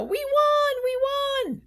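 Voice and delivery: imagined lawyer calling Norma to cheer the win, excited. Retold or imitated. Imagined lawyer's victory phone call.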